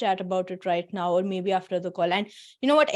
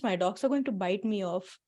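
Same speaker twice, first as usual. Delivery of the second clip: ordinary talk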